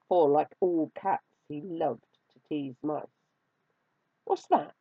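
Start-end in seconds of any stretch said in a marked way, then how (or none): 4.2-4.7 high little mouse voice; small, high-pitched storybook fright; fearful question. A narrator's reading. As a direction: ask timidly as a scared mouse. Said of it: "asked the mouse in fear".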